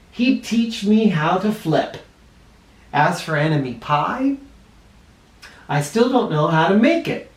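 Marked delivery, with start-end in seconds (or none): none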